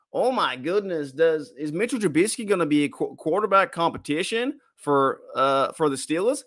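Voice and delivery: put-on a light American accent, hamming up a sports pundit's alarm; mock American accent. Aussie fan mocking a Chat Sports host, in a light mock American accent. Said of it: "that's my American voice".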